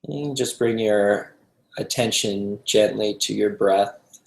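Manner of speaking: calm, unhurried meditation guide with long pauses; guided meditation. Acted. Teacher guiding a group to relax into the breath, calm meditation voice. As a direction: ease them gently into stillness, leaving space between phrases.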